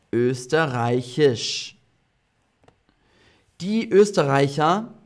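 ordinary talk

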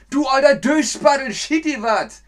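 high, loud northern scolding; mock insult shout. Shown as a demonstration. Teacher yells the northern insult as an example. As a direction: yell it at a clumsy friend.

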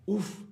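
a high shocked "uf"; high sympathetic uf. Shown as a demonstration. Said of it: "podemos reaccionar diciendo".

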